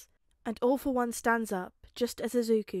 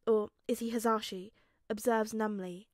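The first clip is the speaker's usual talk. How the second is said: plain reading voice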